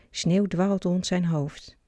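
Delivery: plain reading voice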